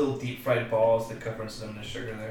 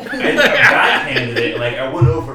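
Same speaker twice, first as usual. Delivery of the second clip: laughing while telling; 2 semitones higher, 10 dB louder than usual. Real, not acted. Laughing retelling moth attack.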